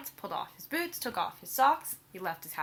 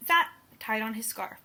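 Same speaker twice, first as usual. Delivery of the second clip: usual speaking voice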